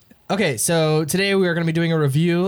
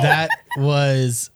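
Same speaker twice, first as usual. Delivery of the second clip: ordinary delivery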